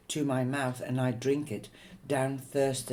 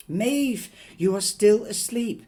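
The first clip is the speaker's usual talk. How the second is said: high, loud urgent call; mother urgently calling her daughter awake; urgent wake-up; 8 semitones higher, 8 dB louder than usual. Acted. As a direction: jolt her out of the nightmare. Mother waking dreaming daughter.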